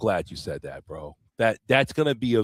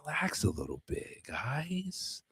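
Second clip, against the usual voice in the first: soft hush; 4 semitones higher, 13 dB quieter than usual. Acted. Calming fans, trailing to whisper.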